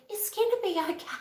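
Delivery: tiny high, soft voice; mock reassurance. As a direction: squeak it out softly, as if convincing yourself. Mimicking her own false reassurance.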